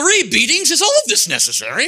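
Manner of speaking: high-pitched, protesting British gentleman; indignant distinguished British donkey; distinguished British accent. Acted. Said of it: "in his best distinguished British accent said".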